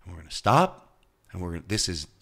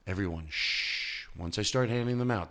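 usual speaking voice

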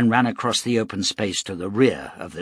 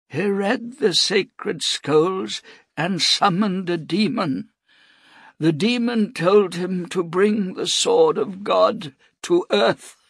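high, slow, frail priest voice; a dying old man, slow and reverent; 9 semitones higher, slower than usual. A narrator's reading.

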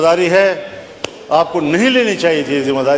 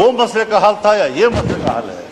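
ordinary delivery

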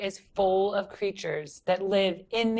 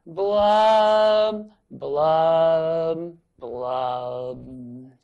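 falling blub; 3 semitones lower, 5 dB louder, much slower than usual. Shown as a demonstration. Teacher leading kids in the droopy blub. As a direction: drop each blub down the scale. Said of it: "Here we go".

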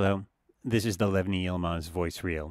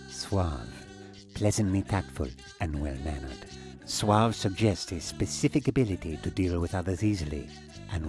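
suave; 2 semitones lower than usual. Shown as a demonstration. Voice reel, suave reading of "suave.

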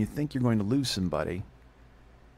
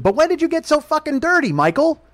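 very high, loud imitation of mom; mock maternal outrage; scolding mother; 9 dB louder than usual. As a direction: pitch it way up, scolding your son. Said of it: "And she goes".

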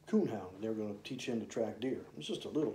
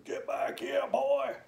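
much higher, yelled call after the runaway dog; retold scream; slower than usual. Two hunters chasing the puppy through the woods, screaming after it. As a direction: holler after a puppy running off with the tail.

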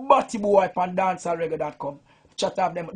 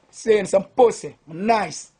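ordinary talk

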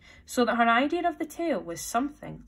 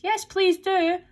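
high, loud plea; eager chorus; 11 semitones higher, 7 dB louder, slower than usual. A narrator's reading. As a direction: call out as a whole eager crowd. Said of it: "all joined in chorus".

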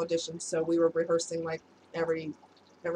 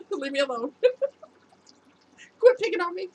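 laughing, higher-pitched mock complaint; playful protest; 9 semitones higher, 5 dB louder than usual. Real, not acted. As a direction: whine playfully through giggles. Playful complaint to teasing viewers.

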